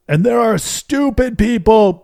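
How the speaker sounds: high, loud and slow stage announcer voice; rally the cheering conference crowd with the punchline; booming keynote. Retold or imitated. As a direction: draw it out like a hyped keynote speaker. Keynote line continued.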